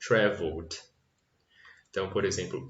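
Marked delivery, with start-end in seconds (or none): 0.1-0.8 "traveled" ending in a clear T sound, travelt. Shown as a demonstration. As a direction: say "traveled" with the final D as a T. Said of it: "Tem esse som de T". ("traveled" com som de T no final)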